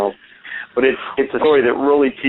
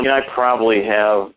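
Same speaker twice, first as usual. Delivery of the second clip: normal talking voice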